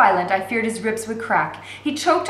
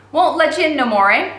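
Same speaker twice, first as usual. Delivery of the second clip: taunting jailer; 6 semitones higher, 7 dB louder than usual. A narrator's reading. Sneering jailer taunts the girl.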